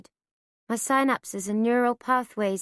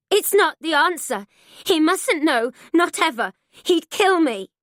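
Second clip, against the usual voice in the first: higher and louder, slowing at the end; frightened pleading; 4 semitones higher, 5 dB louder than usual. A narrator's reading. Mother begging daughter, afraid of the father. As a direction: beg her out of fear.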